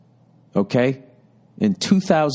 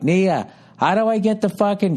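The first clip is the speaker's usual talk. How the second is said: high, pitched-up call up the stairs; yelling upstairs; 9 semitones higher than usual. Retold or imitated. Husband yelling upstairs to his wife for help, high and pleading. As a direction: holler helplessly up to the wife. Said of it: "I yell upstairs".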